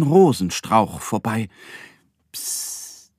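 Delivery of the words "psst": quiet, drawn-out hushing hiss; soft shushing. Acted. Moon man hushing the duck by a sleeping castle, soft shush. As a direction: shush someone very softly. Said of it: "macht das Mondmännchen".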